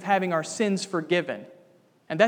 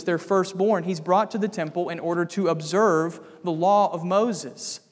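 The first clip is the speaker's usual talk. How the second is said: ordinary talk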